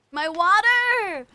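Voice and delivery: high, loud, slow wail; dismayed cry. Real, not acted. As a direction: cry out over the spill. Water spilled.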